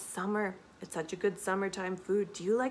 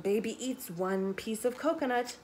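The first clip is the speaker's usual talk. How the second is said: usual speaking voice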